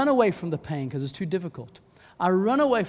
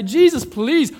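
loud, high, fed-up plea; exasperated appeal; 5 semitones higher, 14 dB louder than usual. Acted. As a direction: demand help, at the end of your patience. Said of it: "the disciples come to Jesus and say".

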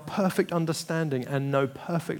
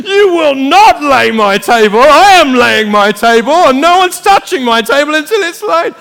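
loud, high, bossy ranting; hammed-up child defending his table; mock possessive yell; 11 semitones higher, 16 dB louder than usual. Acted kid guarding the table.

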